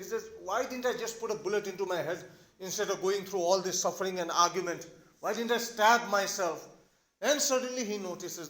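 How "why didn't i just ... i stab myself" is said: ordinary talk, nothing marked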